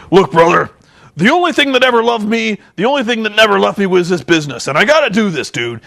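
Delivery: mock-dramatic justification to a friend; Hulk Hogan. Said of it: "is that Hulk Hogan says".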